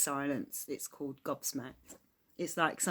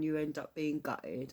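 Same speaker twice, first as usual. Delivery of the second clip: ordinary talk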